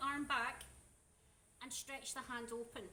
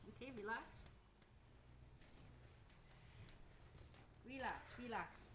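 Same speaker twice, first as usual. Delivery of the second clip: soothing; 3 semitones lower, 10 dB quieter than usual. Real, not acted. Calming client during massage.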